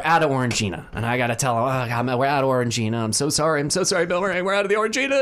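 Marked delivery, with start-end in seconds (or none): 1.6-5.2 mock panicked apology. Starstruck cafe worker telling Bill Murray they're out, increasingly frantic. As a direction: start level, then spiral upward into a retold panic.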